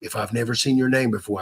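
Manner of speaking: ordinary talk, nothing marked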